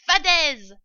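high, loud dismissal; scornful shout. A narrator's reading. Rat dismissing the fox's warning, shouting scornfully. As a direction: throw it back with contempt.

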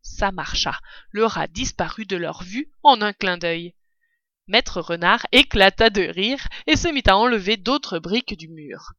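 plain reading voice

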